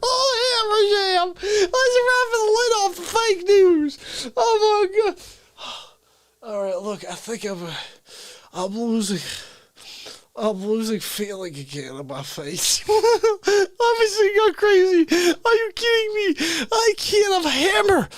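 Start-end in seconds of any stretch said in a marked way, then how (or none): 0.0-5.1 high, laughing through the words; breaking up laughing. Real, not acted. Comic breaking character, laughing at himself. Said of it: "I'm breaking character twice tonight". 12.9-18.1 high, laughing through the words; breaking up laughing. Real, not acted. Comic breaking character, laughing at himself. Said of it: "I'm breaking character twice tonight".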